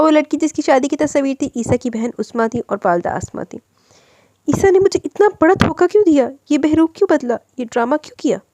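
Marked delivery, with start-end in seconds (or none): 4.5-8.4 high, fading cries of betrayal; wife questions her husband's lie, anguished. A narrator's reading. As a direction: ask it as if heartbroken.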